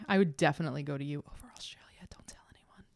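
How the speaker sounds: mock-secret whisper; conspiratorial whisper. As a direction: whisper it like a confession nobody may hear. Secret confession to New Zealand.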